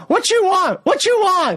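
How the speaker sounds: very high mock demand; high, punchy repeated challenge; challenging women. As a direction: imitate women confronting someone. Women in audience challenge him.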